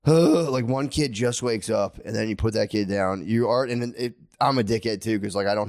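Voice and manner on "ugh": loud, higher sigh of exhaustion; exhausted groan. Retold or imitated. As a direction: heave a worn-out groan. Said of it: "I'll lay there and I'm like".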